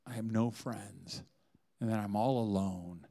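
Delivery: slow, soft lonely admission; mock sad confession. Wife confessing loneliness to husband, slow and soft. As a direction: let it drop low and slow. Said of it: "She goes".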